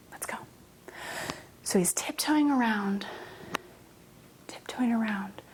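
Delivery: sneaky whisper. Acted. Teacher leading kids tiptoeing through the castle, whispering. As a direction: breathe it out like you're sneaking.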